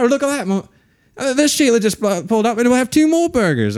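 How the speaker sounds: high mock Australian voice; Australian accent. Retelling the drive-thru scene, high hammy Australian. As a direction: ham up an Aussie bloke ordering. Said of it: "they're in Australian restaurant".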